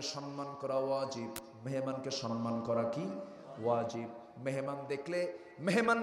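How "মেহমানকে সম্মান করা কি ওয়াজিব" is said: ordinary talk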